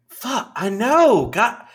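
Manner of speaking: loud, high, drawn-out fake exasperation; exasperated husband. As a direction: sell the phone-call frustration to the wife. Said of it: "I sold it".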